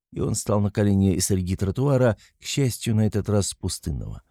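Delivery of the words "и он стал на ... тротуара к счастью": usual speaking voice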